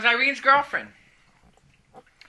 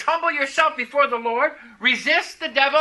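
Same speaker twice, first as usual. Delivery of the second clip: preaching scripture; 6 semitones higher than usual. Acted. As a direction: proclaim the verse with authority. Quotes scripture with force.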